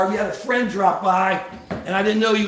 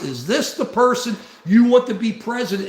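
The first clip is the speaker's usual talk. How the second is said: normal talking voice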